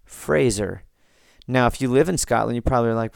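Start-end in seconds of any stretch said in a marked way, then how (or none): 0.0-0.8 Fraser as "phrase" plus "er"; corrected pronunciation. Shown as a demonstration. Podcaster summing up the correction, Fraser like phrase-er. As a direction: clear Z in Fraser, FRAY-zer. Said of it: "and then er".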